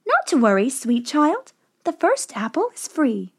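lightly voiced old seller, lilting upward; a hint of sly reassurance; coaxing old woman. A narrator's reading.

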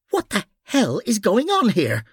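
high, indignant demand; harsh tone. A narrator's reading. Noreen stepping forward to stop two men scuffling, harsh. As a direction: raise the pitch and demand it harshly. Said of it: "Her harsh tone caused".

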